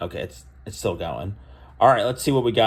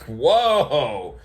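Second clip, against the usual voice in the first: a higher, louder mock gasp of amazement; imitating his impressed whoa; retold whoa; 6 semitones higher, 5 dB louder than usual. Cashier says her niece plays there, he reacts impressed.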